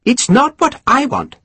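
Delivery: forceful. Acted. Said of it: "with considerable force".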